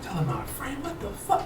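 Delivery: near-whispered disbelief; stunned pondering. Retold or imitated. Man confronted about messages to his friend, hushed bewilderment. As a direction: barely voice it, acting out a baffled thought. Retells stunned puzzling over the accusation.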